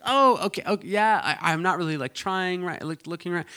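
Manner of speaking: imitating a cornered young woman, high pitched; awkward deflection. Allie awkwardly deflects matchmaking mom.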